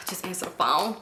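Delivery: unvoiced self-urging whisper; whispered to herself. Bracing herself to answer the dreaded call, whispered.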